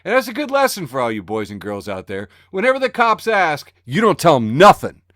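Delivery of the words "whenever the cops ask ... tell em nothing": louder, mock tough-guy warning; hammed-up don't-snitch rule; street-tough advice.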